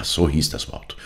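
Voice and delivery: usual speaking voice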